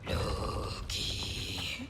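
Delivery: geflüstert, ganz leise; whisper. Acted. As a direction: breathe his name unvoiced, close to his ear. Sneaking up, whispering his name.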